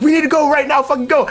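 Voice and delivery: high-pitched, retold rush to leave; urgent rushing. Coworkers coming out to the truck, imitated urgent rushing. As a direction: imitate someone frantically herding everyone out.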